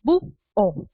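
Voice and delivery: "Bu, O" two syllables said apart with a pause; "Bu, O" split cleanly in two. Shown as a demonstration. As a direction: break "Bu, O" into separate beats like a teacher. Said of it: "De esta manera".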